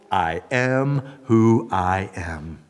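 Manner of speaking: slow, low, weighty declaration; solemn divine voice. Pretend. God telling Moses his name, solemn slow pronouncement. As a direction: lay down each word with gravity.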